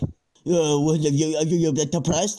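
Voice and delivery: mocking imitation of a friend. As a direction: parrot his question back in mockery. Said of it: "You're mocking me now".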